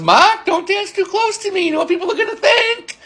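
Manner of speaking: very high-pitched imitation, joking warning; teasing coworker. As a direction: pitch it way up, mock-worried and playful. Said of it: "looked at me with a big smile".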